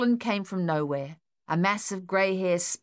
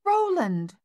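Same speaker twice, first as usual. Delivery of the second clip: much higher, loud cry of the dog's name "Roland!"; grateful cry; 12 semitones higher, 4 dB louder than usual. A narrator's reading. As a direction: call "Roland!" high and loud in shaken relief. Mother cries dog's name.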